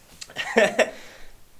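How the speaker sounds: laugh. Real, not acted. Laughs starting a story.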